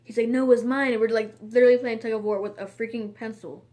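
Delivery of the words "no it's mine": loud, stubborn mock kid insisting; louder childish claim of ownership; insistent retort. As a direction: snap it back like a kid refusing to let go. Tug of war over pencil.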